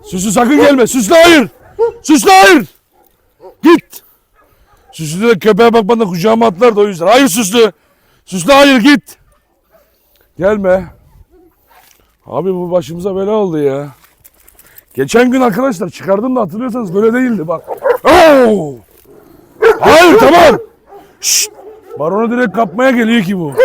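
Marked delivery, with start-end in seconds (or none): 0.0-10.9 shouting at the cat. Real, not acted. Keeping cat away. 18.0-21.5 loud, high cry to stop; alarmed shout. Real, not acted. Dog lunging.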